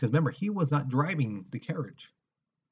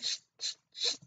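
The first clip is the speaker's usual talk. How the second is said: short repeated "sh" scrapes; imitated metal scraping; 6 dB quieter than usual. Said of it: "if you ever heard, like, two metal scraping".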